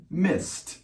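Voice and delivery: "missed" said high as mist, clear final T; missed with a T ending. Shown as a demonstration. Teacher modelling "missed" with a T sound. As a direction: pop up on "missed", end on a crisp T. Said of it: "Miss in the past is".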